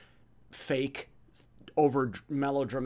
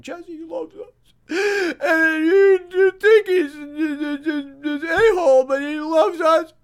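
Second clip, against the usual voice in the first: high, loud, hammed-up wailing; mock crying; 14 semitones higher, 11 dB louder, slower than usual. Kids at the campfire testifying in tears, mock crying. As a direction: imitate a tearful kid blubbering out a testimony. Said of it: "They'd stand up and cry".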